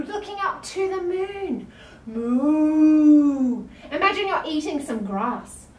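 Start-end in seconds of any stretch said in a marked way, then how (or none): 2.1-3.7 loud, long lowing moo; cow moo. Pretend. Cow pose mooing.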